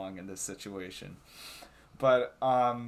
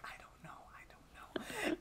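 very soft, helpless whisper; retold whisper; 16 dB quieter than usual. Retelling the answer to Sophia, whispering. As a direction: whisper it back, drawing out the repeat.

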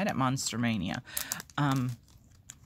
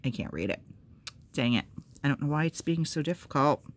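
normal talking voice

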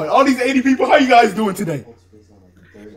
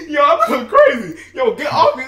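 fast, breathless laughter through the words; cracking up as people pile on; laughing hard; 3 semitones higher, much faster than usual. Real, not acted. Chaotic Discord room bumping him.

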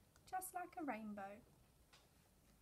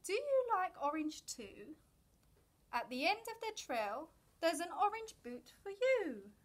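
higher, louder friendly caterpillar; lively caterpillar voice, higher and louder; 4 semitones higher, 7 dB louder than usual. A narrator's reading. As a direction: call out the invitation as a bouncy caterpillar. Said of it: "Caterpillar asks".

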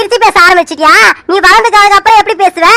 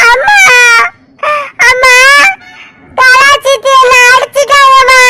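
put-on higher, slow childlike complaint; child tattling tearfully; 5 semitones higher, slower than usual. Little Aisu complaining to mother that aunt hit her, slow childlike voice. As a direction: tell on the aunt like a hurt little girl.

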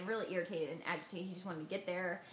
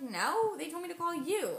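higher, louder exasperated protest; frustrated reply; 9 semitones higher, 5 dB louder than usual. Retold or imitated. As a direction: push back, they sent me to you.

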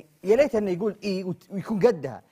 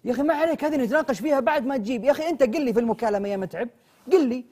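raised, louder telling-off; angry scolding; 7 semitones higher, 6 dB louder than usual. Acted. Yasser scolding Mitab over the missing dessert, loud and raised. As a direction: lecture him for cancelling.

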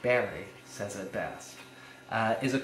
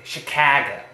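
Chicago very loud on the middle CA; emphatic Chicago, strong middle syllable; amphibrach stress; 2 semitones higher, 10 dB louder than usual. Shown as a demonstration. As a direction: lead a class saying Chicago, punching CA. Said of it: "Say it with me".

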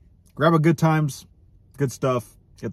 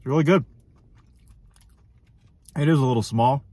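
food in mouth; speaking between bites; talking while chewing; 3 semitones higher, 4 dB louder, faster than usual. Real, not acted. Seen 0: Chewing a burger bite through most of the stretch, jaw moving.

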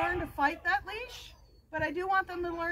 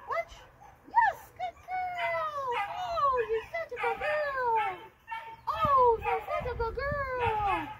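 much higher, slow gushing praise; happy voice; 8 semitones higher, slower than usual. Shown as a demonstration. Trainer praising the puppy in a high, slow happy voice. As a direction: sing her praises, thrilled. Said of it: "Happy voice, you guys".

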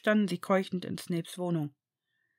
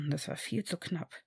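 breathy, much softer whisper; 2 semitones lower, 10 dB quieter than usual. A narrator's reading. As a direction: whisper it in relief. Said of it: "flüsterte er".

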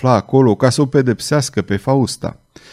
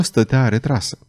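ordinary delivery

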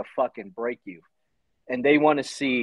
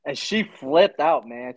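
ordinary talk, nothing marked

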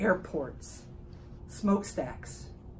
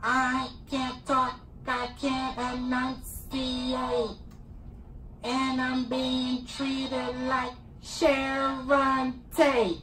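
alien voice; 4 semitones higher, 3 dB louder, much slower than usual. Acted. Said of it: "I got my alien voice".

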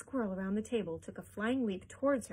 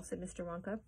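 plain reading voice

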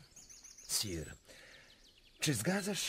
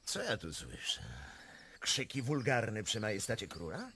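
normal talking voice

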